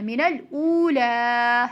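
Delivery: "मिनल ऊला" with long ऊ and long final आ; lengthened vowels. Shown as a demonstration. Teacher illustrates the rule, "ऊला" stretched out. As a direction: hold both vowels of "ऊला". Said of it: "उस वक्त भी हम खींचते हैं".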